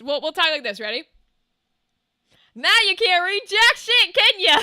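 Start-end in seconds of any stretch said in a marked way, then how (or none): 2.5-4.6 loud, higher, taunting with lips kept shut; high, loud mock taunt without moving the lips; talking mouth closed. Shown as a demonstration. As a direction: push it out loudly through a closed mouth. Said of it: "We'll just talk like this".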